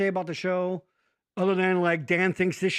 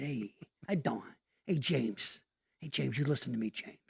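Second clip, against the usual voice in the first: put-on low, soft Italian American mobster accent; quiet tough-guy Italian accent, leaning in; 6 semitones lower, 9 dB quieter than usual. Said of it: "when you do an Italian accent".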